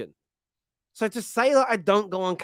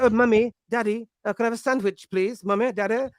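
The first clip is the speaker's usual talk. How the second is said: higher-pitched mock child to parents; polite posh child; 4 semitones higher than usual. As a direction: mimic a well-spoken kid asking sweetly. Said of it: "you be like".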